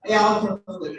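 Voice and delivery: laughing through the words. Real, not acted. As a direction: laugh while wrapping up. Laughs at time overrun.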